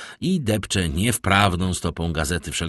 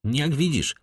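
higher, firm opening; 9 semitones higher than usual. A narrator's reading. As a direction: state it decisively. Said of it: "powiedział Albert stanowczo".

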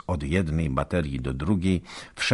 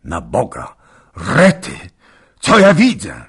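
loud cry; 3 semitones higher, 11 dB louder than usual. Acted. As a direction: cry out in alarm at what he sees. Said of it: "krzyknął nagle wielkim głosem". (nagły okrzyk przerażenia)

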